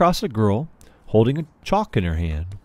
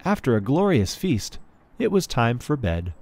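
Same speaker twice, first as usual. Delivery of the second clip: ordinary delivery, nothing marked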